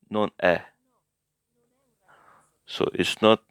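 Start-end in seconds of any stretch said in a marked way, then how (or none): 0.1-0.6 è in Non è as an open "eh". Shown as a demonstration. Teacher repeating the correction, è open like "eh". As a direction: say Non è ending on a wide "eh". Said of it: "the grave accent".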